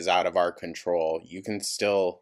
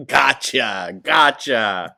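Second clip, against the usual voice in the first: loud, high mocking "gotcha"; sarcastic gotcha; 5 semitones higher, 9 dB louder, much slower than usual. Real, not acted. Reactor sarcastically agreeing with the vlogger's excuse, loud and high. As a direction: throw it back with exaggerated fake understanding.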